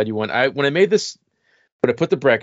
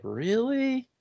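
incredulous; 14 semitones higher, 6 dB quieter than usual. Retold or imitated. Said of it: "people would be like".

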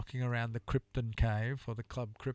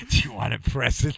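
giggling; 8 dB louder than usual. Real, not acted. Giggling through teasing question.

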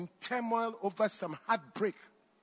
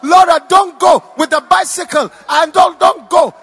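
screaming; 10 semitones higher, 21 dB louder than usual. Retold or imitated. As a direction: scream it desperately after a departing plane. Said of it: "I was screaming".

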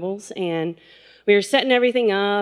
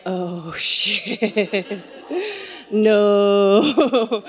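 drawn-out mock horror through laughter; laughing dismay; much slower than usual. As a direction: let the words dissolve into laughing. Retold horror, laughing as she tells it.

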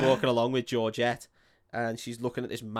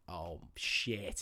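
dismayed curse; 8 semitones lower, 10 dB quieter than usual. Retold or imitated. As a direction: lower and softer, dismayed.

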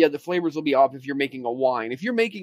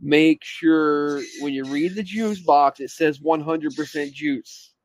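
slowed, deliberate instruction; slow emphasis; slower than usual. Real, not acted. Cohost stresses reading the juice label, slow and deliberate. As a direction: spell it out slowly so it sticks. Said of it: "I gotta emphasize something".

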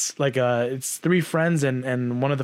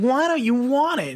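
mock mom voice; 10 semitones higher than usual. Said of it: "she's like".

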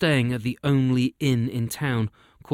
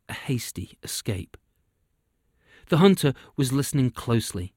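ordinary delivery

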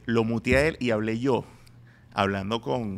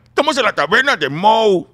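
loud, high Mexican-accented cartoon dad; 9 semitones higher, 11 dB louder than usual. Shown as a demonstration. Comedian showing the correct dubbed take, exaggerated Mexican accent. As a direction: belt it out with a big Mexican lilt. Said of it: "tiene que ser mexicano".

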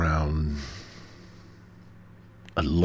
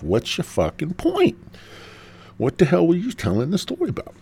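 impatient listener; 11 semitones higher than usual. Pretend. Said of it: "asking yourself".

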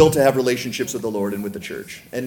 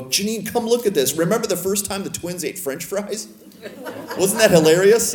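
excited call; 7 semitones higher than usual. Retold or imitated. Calling wife to see memory.

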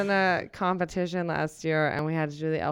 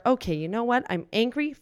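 usual speaking voice

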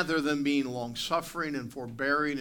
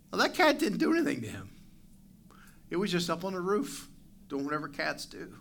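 ordinary talk

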